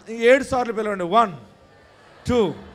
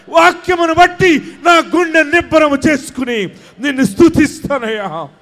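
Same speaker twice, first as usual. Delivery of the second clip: fervent prayer; 6 semitones higher, 8 dB louder than usual. Acted. Pastor leading the congregation's prayer, crying out fervently. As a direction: pour it out to God at full force. Prays at full force.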